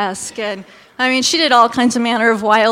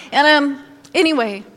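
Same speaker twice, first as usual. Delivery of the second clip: normal talking voice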